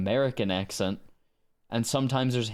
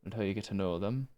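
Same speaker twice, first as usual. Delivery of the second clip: put-on quick, softer Danish-accented echo; imitated Danish accent; 4 semitones lower, 6 dB quieter, faster than usual. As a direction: mimic a Dane's English under your voice. Mimicking the Danish speaker's accent.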